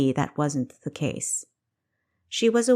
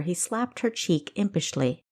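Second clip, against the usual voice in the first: usual speaking voice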